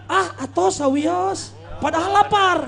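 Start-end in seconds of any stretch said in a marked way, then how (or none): 0.1-1.5 slow, coy ah atos sawios; polite refusal. Retold or imitated. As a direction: decline the food slowly and politely. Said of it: "pasti bilang".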